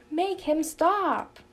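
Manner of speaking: high, loud and slow whining; teen sister begging mom to stop her brother; whiny plea. A narrator's reading. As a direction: drag it out, a wailing complaint. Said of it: "whined Janey".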